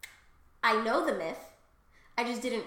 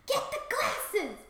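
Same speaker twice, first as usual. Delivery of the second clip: high, drawn-out urgent command; mock alarmed cry; 10 semitones higher, 3 dB louder, slower than usual. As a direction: cry out in sudden pretend panic. Retold alarm about pet chewing glasses.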